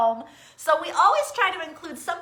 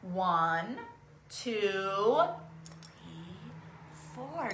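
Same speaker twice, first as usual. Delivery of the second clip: counting; 6 semitones lower, 10 dB quieter, much slower than usual. Shown as a demonstration. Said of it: "Can you practice counting with me?".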